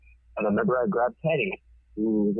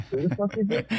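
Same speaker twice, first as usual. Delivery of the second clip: confused wife; 11 semitones higher than usual. Retold or imitated. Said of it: "and she's like".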